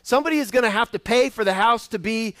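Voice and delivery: ordinary talk, nothing marked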